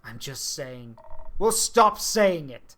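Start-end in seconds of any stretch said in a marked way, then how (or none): 1.4-2.8 louder, slow and fed up; angry shout. Acted. Telling Clay to stop disrespecting her, angry shout. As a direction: slam it down hard. Companion keeps insulting the dead.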